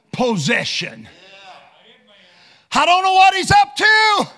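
loud, high preacher's shout; fiery preaching. Acted. Preacher crying out to the congregation, loud and high. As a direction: preach it full force from the pulpit.

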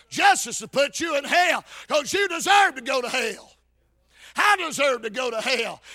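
ordinary talk

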